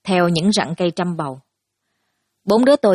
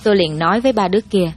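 ordinary delivery

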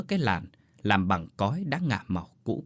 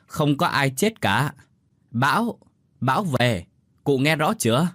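raised, higher voice for someone hard of hearing; insistent, loudly spelled out; near-yelling into ear; 4 semitones higher, 4 dB louder than usual. A narrator's reading. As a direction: make the deaf old woman hear. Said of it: "ghé sát tai mà nói như gào lên".

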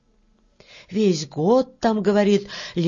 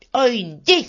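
high, forceful command; threatening order; 4 semitones higher, 4 dB louder than usual. Acted. Red-haired worker squares up to beetle, threatening. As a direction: order her away sharply.

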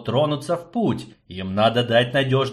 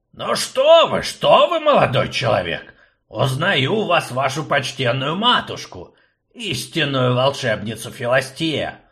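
put-on fussy old man; 3 semitones higher than usual. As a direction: play the fussy old rector. Akcium fawning.

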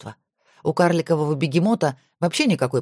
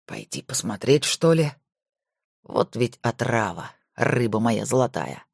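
dreamy; 5 semitones lower than usual. A narrator's reading. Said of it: "мечтательно спросил сам у себя". (мечтательно, тихо, себе)

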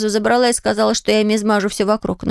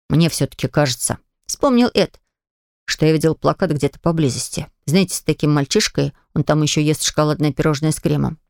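Ed's lower, calm voice; lower boy voice; 8 semitones lower than usual. Acted. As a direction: voice him a bit lower. (голос пониже)

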